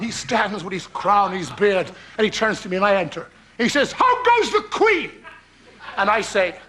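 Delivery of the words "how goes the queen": high, slow, posh English stage king; hammed-up posh English actor; posh English accent. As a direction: declaim it in a plummy English accent. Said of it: "In his posh English accent".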